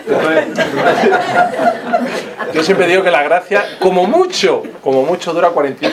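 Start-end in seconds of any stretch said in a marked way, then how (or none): 0.5-2.5 high burst of laughter. Real, not acted. Laughs at his own joke.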